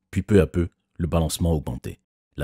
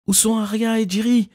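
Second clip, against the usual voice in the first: frantic yell. A narrator's reading. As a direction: scream for your missing friends. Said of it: "a hurlé Emma frénétiquement".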